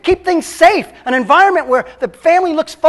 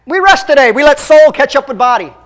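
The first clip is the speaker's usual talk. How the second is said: louder, punchier repeat of the line; repeated with emphasis; 3 semitones higher, 5 dB louder than usual. Shown as a demonstration. Preacher repeats the quote to drive it home, emphatic. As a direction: hit it again with force for the congregation. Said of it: "Is that good?".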